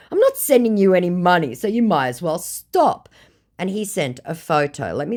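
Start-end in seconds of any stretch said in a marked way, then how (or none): 0.1-3.1 higher, louder scolding; retold angry reply. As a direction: tell him off with contempt.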